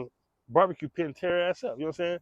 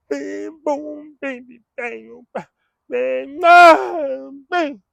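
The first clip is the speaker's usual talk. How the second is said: higher, mock rapping noises; deaf rapper impression; 9 semitones higher, 8 dB louder, much slower than usual. Deaf brother rapping along, mocking imitation. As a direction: bounce out the sounds like a deaf rapper. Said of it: "dancing and rapping, singing along".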